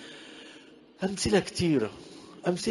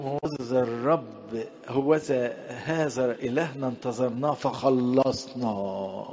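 slow verse reading; 2 semitones lower, slower than usual. Shown as a demonstration. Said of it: "slowed verse reading".

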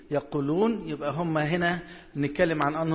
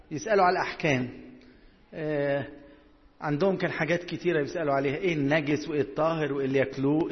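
ordinary talk

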